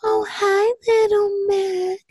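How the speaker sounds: put-on high, sweet toddler voice, slow on little Mac; a high tender greeting from a toddler; imitating little girl. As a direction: coo it like a little sister greeting her baby brother. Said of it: "she'll be like".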